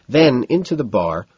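ordinary delivery, nothing marked

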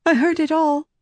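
usual speaking voice

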